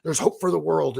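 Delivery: eager, upbeat pep-talk side; hopeful inner voice. Retold or imitated. His hopeful side cheering him on, eager. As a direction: cheer yourself on with hope. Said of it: "one side's like".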